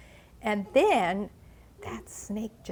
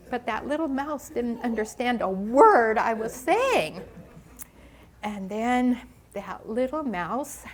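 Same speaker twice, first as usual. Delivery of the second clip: usual speaking voice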